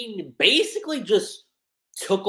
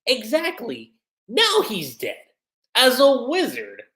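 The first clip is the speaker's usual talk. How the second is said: sly, teasing reveal, rising higher and louder to the punchline; cheeky; 8 semitones higher, 4 dB louder than usual. Retold or imitated. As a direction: savour the pun like a smug villain. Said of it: "Irene being so cheeky".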